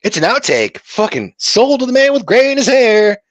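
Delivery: high, rising thrilled outburst; excited discovery. Retold or imitated. Gamer tells of finding a rare outtake, high excited gush. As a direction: blurt it like you just found treasure. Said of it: "I'm like".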